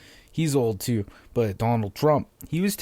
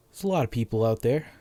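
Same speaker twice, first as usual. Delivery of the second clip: normal talking voice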